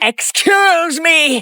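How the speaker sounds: very drawn-out, theatrical indignation; hammed-up English teacher outrage; affronted teacher. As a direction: stretch it out as if deeply offended. English teacher affronted by work reminder.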